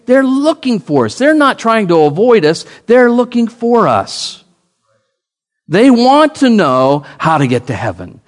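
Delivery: high, loud preaching build; full-force sermon cadence. Acted. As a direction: drive it home from the pulpit. Preaching climax.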